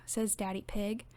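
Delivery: usual speaking voice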